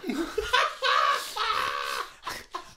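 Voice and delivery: big high burst of laughter. Real, not acted. Laughing at joke.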